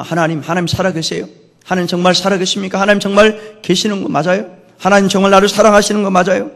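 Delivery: acted questioning of God, building slightly; doubting complaint. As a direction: press God for an answer, half protesting. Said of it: "그렇게 불평할 때가".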